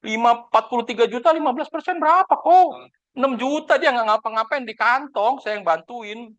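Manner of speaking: high-pitched, indignant reckoning; retold exasperation. Agent arguing with colleague over easy commission, exasperated.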